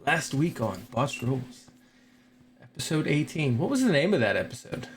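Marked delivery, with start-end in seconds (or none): 0.1-1.7 sultry narrator. Acted. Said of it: "With [name]'s sultry voice".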